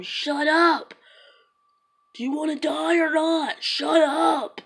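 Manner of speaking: high-pitched imitated yelling; screaming. Shooter threatening terrified kids, screaming. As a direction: act out yelling at someone to be silent. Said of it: "like, screaming at them".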